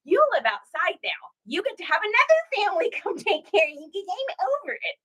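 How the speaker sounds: high, fast mock-fed-up parent; mother scolding her fourteen-year-old, mock fed up; exasperated mom. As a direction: pretend to kick the teen out, laughing. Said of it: "I was like".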